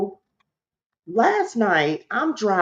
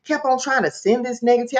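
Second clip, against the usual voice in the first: ordinary delivery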